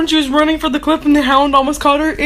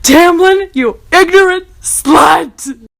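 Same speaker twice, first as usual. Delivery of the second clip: higher, louder mock outburst, ignorant dragged slow; mock insult; 5 semitones higher, 8 dB louder, slower than usual. Reader hurling the same comic insult at Tamlin, mock shouting. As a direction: fling the line like a sketch punchline. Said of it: "same insult at Tamlin".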